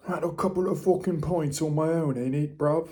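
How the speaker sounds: mock British accent. Vlogger joking about pints on his own, mock British accent. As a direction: ham up a British pub-goer accent.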